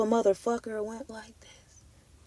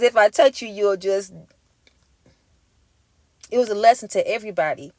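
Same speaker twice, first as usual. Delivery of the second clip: ordinary delivery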